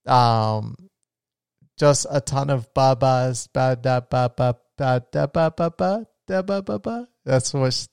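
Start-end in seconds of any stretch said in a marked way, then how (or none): 1.8-7.1 put-on playful ba-ba baby talk; baby babble imitation. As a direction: babble like an infant. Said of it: "that's how she sounds".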